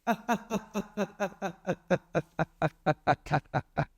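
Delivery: long drawn-out ghostly cackle; a slow, menacing spirit cackle; evil cackle. Acted. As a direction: laugh like a spirit rising out of smoke. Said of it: "अब वो क्या कहती है".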